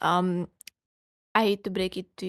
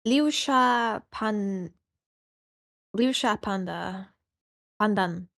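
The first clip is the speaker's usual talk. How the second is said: slow reading; much slower than usual. Real, not acted. Struggling to read a label.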